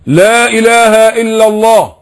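slow, high and loud, lips held still; loud slow shahada. Shown as a demonstration. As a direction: proclaim it slowly and loudly without lip movement. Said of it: "بقولها بصوت عالي أوي".

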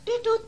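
sudden squeaky high call; high code sound. Shown as a demonstration. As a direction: chirp the signal high. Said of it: "pura-pura jadi arwahnya Papa".

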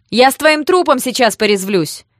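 loud, high-pitched threat; piercing yell. A narrator's reading. Sister charges at the thugs, yelling piercingly. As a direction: scream it at the attackers. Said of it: "раздался пронзительный вопль".

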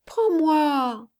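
put-on high, drawn-out little-girl plea; child's pleading. A narrator's reading. Little girl asking to be carried, pleading. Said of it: "supplia-t-elle".

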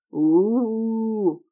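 drawn-out spooky oooh; hammy theater ghost wail; ghostly howl. A narrator's reading. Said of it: "провыла".

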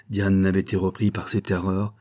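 ordinary delivery, nothing marked

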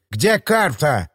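a menacing lisp on every s and sh, voiced role; lisping thug. A narrator's reading. Limping thug demands the map, lisping. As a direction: lisp it out like a crippled thug with a knife. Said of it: "прошепелявил хромоногий".

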